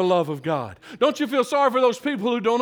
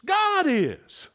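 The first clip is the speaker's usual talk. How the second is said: GOD pitched high, "is" falling after it; emphatic high GOD; stress on GOD; 6 semitones higher than usual. Shown as a demonstration. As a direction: lift the word God, then drop on "is". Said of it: "emphasize that word in your mind".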